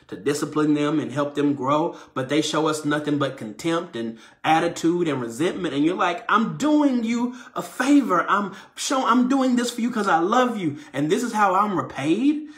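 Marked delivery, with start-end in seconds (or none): 6.3-12.5 mock wounded whine. Hurt parent complaining to kids.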